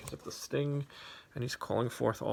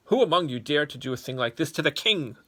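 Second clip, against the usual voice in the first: loud, higher, ending in a firm fall; repeated angry demand; 4 semitones higher, 9 dB louder than usual. Acted. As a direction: press the question again, sternly. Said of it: "Solomon repeated".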